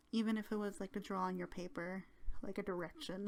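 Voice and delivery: ordinary delivery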